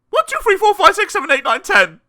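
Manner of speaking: rapid-fire high, loud count; panicked counting. A narrator's reading. Mister Jelly counting to calm himself, high frantic gabble. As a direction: rattle the numbers off in one frantic breath.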